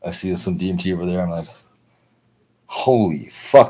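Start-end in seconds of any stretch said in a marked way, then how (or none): 2.7-3.7 drawn-out, slightly raised mock amazement; slow, blown-away exclamation; stunned reaction. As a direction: stretch it out slowly in retold awe. Retold reaction after intense trip.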